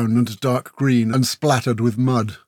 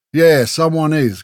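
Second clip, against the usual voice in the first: slow, slightly raised menacing agreement; pointed agreement; 4 semitones higher, 6 dB louder than usual. A narrator's reading. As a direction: agree as a veiled threat. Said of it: "Grell agreed".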